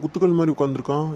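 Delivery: normal talking voice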